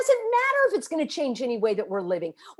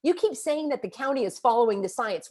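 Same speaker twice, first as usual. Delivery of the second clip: usual speaking voice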